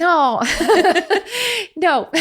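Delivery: laughing no, no; breaking into giggles. Real, not acted. Laughing off her own Italian cliché.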